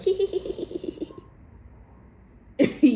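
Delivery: high breathy creep's giggle; creepy giggle. Retold or imitated. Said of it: "And they're like".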